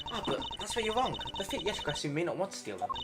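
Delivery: put-on high, bright young thief; high-pitched eager girl; perky girl voice.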